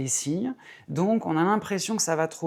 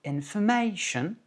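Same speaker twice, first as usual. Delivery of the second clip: "information" slow, MAY long and raised; English "information", higher pitch on a long MAY; stress on MAY; 4 semitones higher, slower than usual. Shown as a demonstration. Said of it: "la syllabe accentuée, c'est may".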